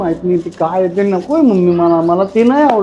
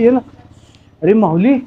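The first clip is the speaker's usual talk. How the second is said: ordinary delivery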